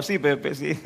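sarcastic, laughing. Acted. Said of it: "Lenguaje actual".

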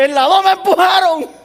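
high, loud, laughing through it; laughing retelling. Retold or imitated. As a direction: crack up at the memory. Retelling being pushed, laughing.

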